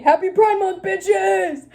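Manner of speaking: loud, high celebratory yell; excited. Retold or imitated. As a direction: cheer it out. Reading old diary cheer.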